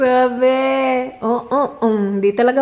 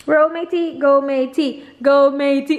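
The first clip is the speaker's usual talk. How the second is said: ordinary talk, nothing marked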